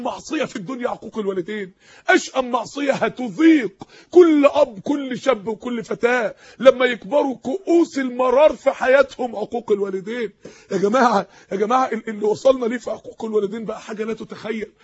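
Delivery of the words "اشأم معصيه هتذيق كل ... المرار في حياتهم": louder, slow, tearful preaching shout; crying out in anguish. Real, not acted. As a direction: cry the warning out to the congregation, slowly. Preacher's impassioned warning.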